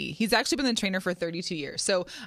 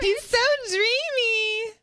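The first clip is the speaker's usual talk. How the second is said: swooning; 4 dB louder, slower than usual. Pretend. Teasing about a crush.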